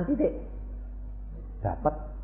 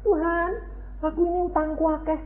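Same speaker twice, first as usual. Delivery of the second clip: high, fast confession of debts; a quick sly plea; mock debtor's prayer; 14 semitones higher, faster than usual. Indebted believer pleads with God, high and fast. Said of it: "Nakalnya apa?".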